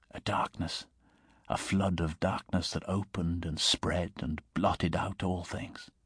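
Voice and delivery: ordinary delivery, nothing marked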